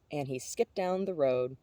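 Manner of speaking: ordinary delivery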